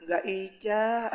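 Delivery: "Ica" said cutely, soft and slow; childish lisp. Acted. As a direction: lisp "Ica" with drawn-out vowels. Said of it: "ngomongnya cadel". ("Ica" diucapkan cadel dan manja)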